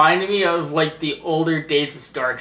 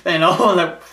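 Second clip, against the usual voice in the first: ordinary talk, nothing marked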